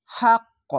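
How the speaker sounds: হাক্ক cut off hard on the qaf, high; wrong stopped pronunciation. Shown as a demonstration. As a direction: stop dead on হাক্ক. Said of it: "এখানে যদি থামতে গেলে".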